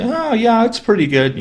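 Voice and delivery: high, breezy brush-off; mock upbeat dodge; fake cheerful answer. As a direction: toss it off like all is fine. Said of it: "Typically, they'll say".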